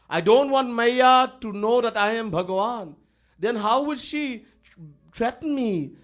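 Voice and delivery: ordinary talk, nothing marked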